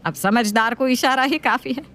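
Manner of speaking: nagging mother-in-law voice. Acted.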